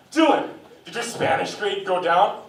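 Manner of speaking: dad checking grades on his app, mock menacing; mock scary dad. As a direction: loom over your kid with a loud, slow question. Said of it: "the Kool-Aid guy can be so scary".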